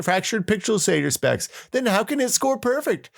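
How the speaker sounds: high, exaggerated outraged whine; shrill-pitched mock of an angry forum commenter; mocking forum poster. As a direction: ham up an indignant nitpicker reading his post. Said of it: "So now Spanky says".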